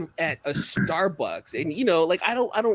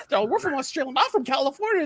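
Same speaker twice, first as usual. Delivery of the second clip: higher-pitched imitation of indignant strangers; retold retort; 10 semitones higher than usual. Strangers correcting a surprised Californian in line, retold retort.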